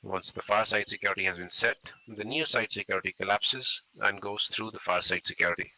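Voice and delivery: loud voice carried across a road; projecting voice. Real, not acted. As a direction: project to the team on the far side.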